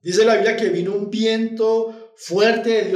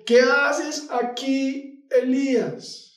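higher, very slow questioning voice; God's voice; 6 semitones higher, much slower than usual. Acted. God calling Elijah out of the cave, slow and high. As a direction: draw out the divine question. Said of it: "una voz le dijo".

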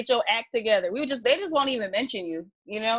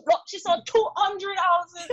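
put-on higher, slow Chinese Jamaican grandma accent; grandma's accent; 7 semitones higher, slower than usual. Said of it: "that's how she brags".

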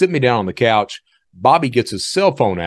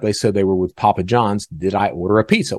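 usual speaking voice

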